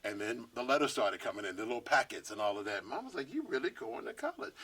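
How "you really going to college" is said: mom's voice jumps way higher in disbelief; mother stunned her child means it; surprised mom. Retold or imitated. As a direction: ask it like you can't believe your kid. Mom amazed at college plans.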